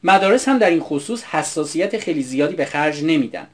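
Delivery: ordinary delivery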